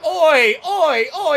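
loud high shouted calls; yelling oi. Real, not acted. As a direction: yell to get its attention. Yelling at an enemy in game.

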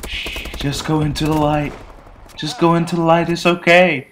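hushed, mock-gentle coaxing, rising in pitch; mock soothing. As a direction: soothe him like a dying man, jokingly. Mock soothing for pillow reenactment.

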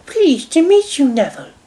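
high-pitched gracious lady; high lady's voice; Dowager Hat. A narrator's reading. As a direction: greet politely as a grandmother, pitched high. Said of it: "said Dowager Hat".

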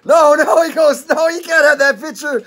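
high, loud playful protest; mock protest. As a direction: play the politician refusing the photo.

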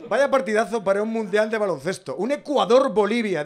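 mock commentator hype. Comedian mocking a minor match to the audience, hammed-up excitement.

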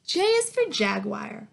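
ordinary talk, nothing marked

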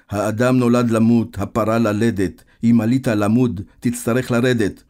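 loud, drawn-out verse recital; booming sing-song recitation; reciting. A narrator's reading. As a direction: declaim the rhyme line by line. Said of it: "פתאום מדקלם מתוך שינה את השיר".

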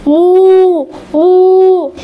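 loud, long imitated moo; cow moo. Animals call to the owl, loud mooing. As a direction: moo out loud, holding the vowel. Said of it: "called out with the funny voices".

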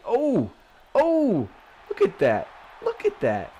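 sudden high loud oh, oh; excited yelp. Real, not acted. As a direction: burst out thrilled at the play. Big play excitement.

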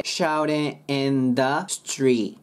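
slow "shouting in the street" as "shau-ren"; silent G. Shown as a demonstration. Teacher demonstrating the corrected phrase, slow and linked. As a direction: stretch it with tapped T and no G. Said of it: "G消えて".

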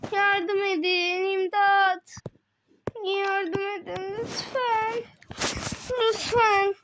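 high, slow, tearful pleading; crying for help. Acted. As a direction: beg for help through tears. Character calls for help.

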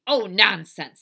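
loud, dismissive scoff; Eugenia Lincoln. Acted. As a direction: brush a sister off forcefully. Bossy sister dismissing.

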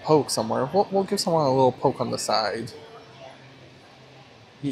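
ordinary talk, nothing marked

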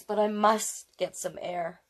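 plain reading voice

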